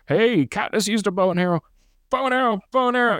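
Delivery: high, cartoonish mock announcement. Mocking the film's nudge to fans, in a high cartoon voice.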